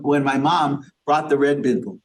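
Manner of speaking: ordinary delivery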